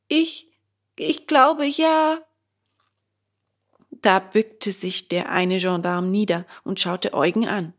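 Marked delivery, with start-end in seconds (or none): 0.1-2.2 put-on high, faltering boy's voice; unsure, scared reply; hesitant puppet boy. Bengele hesitantly answers the gendarme, high and faltering.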